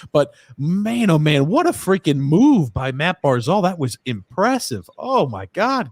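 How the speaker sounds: high, loud, wowed fan; excited raving. Real, not acted. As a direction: rave in amazement over the move. Still marvelling at Barzal's move.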